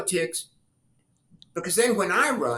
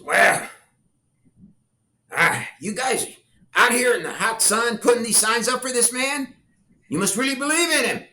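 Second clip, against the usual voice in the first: put-on a higher, louder old homeowner, amused and admiring; voicing the homeowner; 7 semitones higher, 4 dB louder than usual. Homeowner praising the kids out in the sun, a raised, amused voice. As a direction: play the friendly old man who is impressed by the kids.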